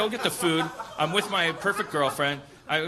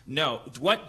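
normal talking voice

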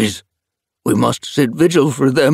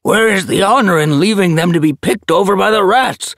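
higher, louder indignant elder; protesting elder; 11 semitones higher, 7 dB louder than usual. A narrator's reading. Elder protests to the leader, loud and indignant. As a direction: demand honour for the dead. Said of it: "Oakstep protested".

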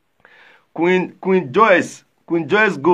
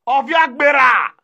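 loud, higher call across the yard; shouting a name; 6 semitones higher, 3 dB louder, slower than usual. Real, not acted. Calling out for Benjamin's father.

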